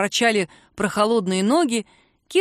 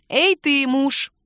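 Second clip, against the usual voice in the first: higher, louder teasing call; playful call; 6 semitones higher, 4 dB louder, much slower than usual. Acted. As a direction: call out to him cheekily. Kira calling him playfully.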